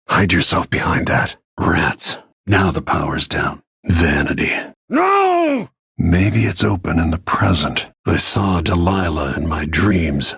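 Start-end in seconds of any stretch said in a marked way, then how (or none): 4.9-5.7 a sudden, much higher shout of No; anguished shout. Acted. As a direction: cry it out in horror. Sudden desperate outcry.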